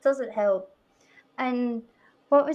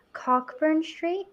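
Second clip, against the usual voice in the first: slow, higher, rising question; imitating puzzled visitors; 5 semitones higher, 3 dB quieter, slower than usual. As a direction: draw out "Cockburn" as COCK-burn, questioning. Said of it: "and they're like".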